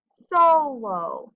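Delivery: "solo" with a long, drawn-out first O; slow "solo", holding the "oh"; long vowel. Shown as a demonstration. Teacher showing pupils "solo", long O stretched out. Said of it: "I hear a long vowel".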